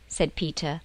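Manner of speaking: plain reading voice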